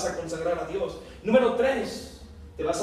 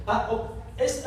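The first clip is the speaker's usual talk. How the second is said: normal talking voice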